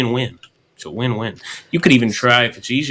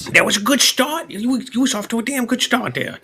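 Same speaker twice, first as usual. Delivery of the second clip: much higher, egging him on; high-pitched encouragement; 13 semitones higher than usual. Real, not acted. Coach praising a shaky first try, high-pitched encouragement. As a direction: pitch it way up, coaxing. Coach cheering on a failed attempt.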